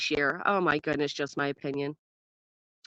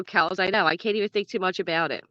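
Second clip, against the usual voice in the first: ordinary delivery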